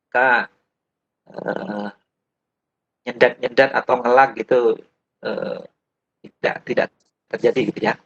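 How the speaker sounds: choppy audio cutting in and out; bad connection. Real, not acted. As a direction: voice breaking up over a laggy call. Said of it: "Putus-putus nih suara [name]".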